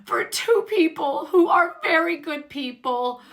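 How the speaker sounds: high, weepy mock-sob voice; imitating sobbing. Lisa pleading for Bronwyn and his family, mock sobbing. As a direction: whimper it out like she's still sobbing. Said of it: "she's sobbing again".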